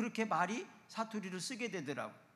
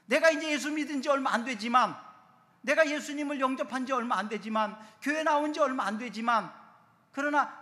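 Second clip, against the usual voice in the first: loud, higher preacher's cry; preaching at full force; 7 semitones higher, 9 dB louder than usual. Acted. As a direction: lift the congregation with rising fervour.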